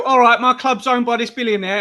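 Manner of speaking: pundit mocking a fan's shrug about owners, high mock voice. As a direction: lightly take the mick out of a smug supporter. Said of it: "Just go".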